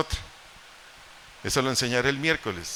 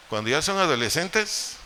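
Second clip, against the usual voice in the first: usual speaking voice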